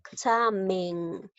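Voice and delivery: "examen" slowly as e-xa-men; teacher syllabifying "examen"; syllable by syllable. Shown as a demonstration. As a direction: draw out each syllable of "examen". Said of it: "De esta manera es decir".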